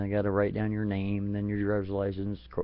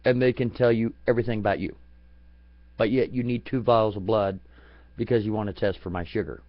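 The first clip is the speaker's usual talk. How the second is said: normal talking voice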